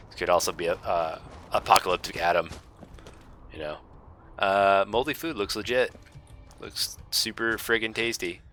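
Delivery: ordinary talk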